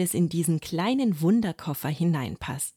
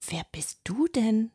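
put-on a frightened boy's hushed question; cautious whisper; 5 semitones higher than usual. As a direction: ask it carefully under your breath. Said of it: "fragt Finn vorsichtig".

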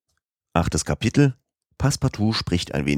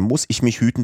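higher, worried outburst; anxious eagerness; 6 semitones higher, faster than usual. Acted. Passepartout frets to Fix about missing the boat, higher and anxious. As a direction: blurt out the worry.

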